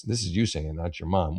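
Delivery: normal talking voice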